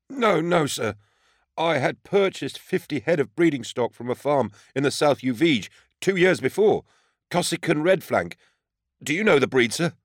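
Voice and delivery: high, earnest farmer pleading his case; high-pitched, eager-to-convince character; nervous defendant. A narrator's reading. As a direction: explain yourself anxiously in a high voice. Defendant explains himself under questioning.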